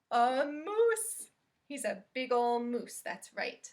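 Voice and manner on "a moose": gasp, then a high, loud reveal; mock surprise. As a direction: wow the kids with the moose. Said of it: "reading to children".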